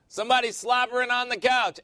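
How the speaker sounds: high, loud mock exclamation; imitating his wife. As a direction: call it out in a hammed-up wifely voice. Mimicking wife around the house.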